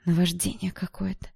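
plain reading voice

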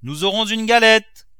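high, loud announcement across the house; triumphant shout. A narrator's reading. Cook announcing cake to the young mistress, high excited shout. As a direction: holler the good news. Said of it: "cria la cuisinière".

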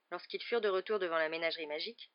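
plain reading voice

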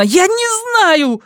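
high, drawn-out desperate cry; shouted. A narrator's reading. Saga interrupting Joona's accusation, crying out. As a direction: cry out, voice shooting up. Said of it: "крикнула Сага".

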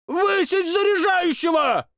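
very high furious royal yell; shouted order. Acted. King yells to flog the loader, very high shout. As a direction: scream for punishment. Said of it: "крикнул он".

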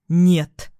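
firm, abrupt refusal; hard refusal. A narrator's reading. Ex-husband refuses to see her, hard refusal. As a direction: refuse him flat out with a firm push. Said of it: "жёсткий отказ".

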